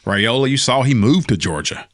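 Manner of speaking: ordinary delivery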